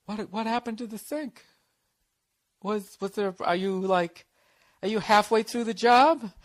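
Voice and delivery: high, tentative mock-girlfriend voice; puzzled girlfriend. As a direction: ask haltingly, imitating a confused partner. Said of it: "She's like".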